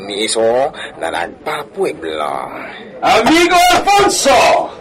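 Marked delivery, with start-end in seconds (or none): 3.0-4.7 shouted greeting, much higher and louder; calling out. Acted. Greeting his friend Alfonso from a distance, a loud shouted call. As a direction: hail a friend from across the street.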